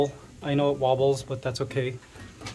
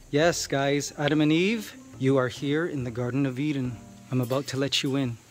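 usual speaking voice

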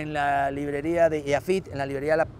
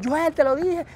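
exasperated told you so; 11 semitones higher, 5 dB louder, slower than usual. Retold or imitated. Ana scolds him, told you so.

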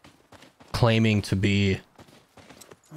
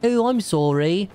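put-on high, slow English-accented apology; hammed-up polite British sorry; mock English apology; 7 semitones higher, slower than usual. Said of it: "I'm English".

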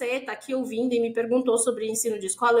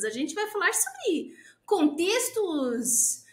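mock-gushing progressive teacher, pitched high with drawn-out words; singsong hype, stretching the words; experimental-school teacher; 5 semitones higher, slower than usual. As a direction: parody an airy, over-enthusiastic educator. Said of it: "aí tem as experimentais, que é tipo".

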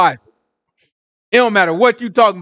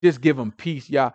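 ordinary talk

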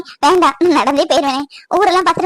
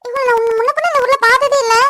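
raised, higher voice in alarm; urgent alarm; 5 semitones higher than usual. Acted. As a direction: cut the call short, alarmed at finding someone collapsed. Finds woman collapsed, cuts off call. (பதற்றத்தோடு உயர்ந்த குரல்)

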